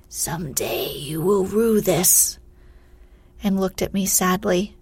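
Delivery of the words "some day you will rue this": slow, breathy, drawn-out warning; slow spectral foreboding; ominous specter. A narrator's reading. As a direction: draw it out like a ghostly threat. Specter's parting warning to the shepherd.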